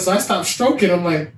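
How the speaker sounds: ordinary delivery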